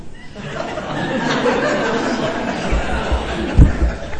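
real laugh; high breathy laugh. Real, not acted. Laughs at his own story.

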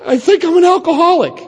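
hesitant high-pitched admission; timid confession. Retold or imitated. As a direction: squeak it out like a scared kid. Confessing on the helpline phone.

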